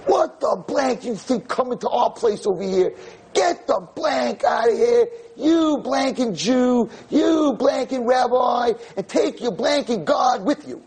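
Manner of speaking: high, furious shouted curses; angry girl's shouting. Retold or imitated. Pierced teen girl screams insults at the rabbi, high and furious. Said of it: "she says".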